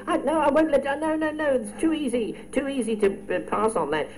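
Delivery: high, teasing no no no; mock exasperated protest. Real, not acted. Quizmaster teasing a contestant who missed an easy one, mock exasperated. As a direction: playfully refuse to let the easy question pass.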